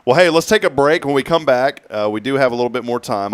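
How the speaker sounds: loud, upbeat radio announcer; radio host voice. Acted. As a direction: toss to break, upbeat.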